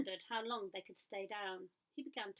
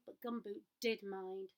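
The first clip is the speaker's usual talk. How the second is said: ordinary delivery, nothing marked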